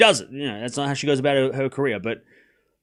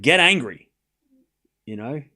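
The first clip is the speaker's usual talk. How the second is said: imagined coach firing her up; 4 semitones higher than usual. Retold or imitated. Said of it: "I'd be like".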